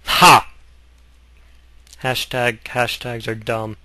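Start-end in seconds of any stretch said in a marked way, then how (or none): 0.1-0.5 mock triumphant ha.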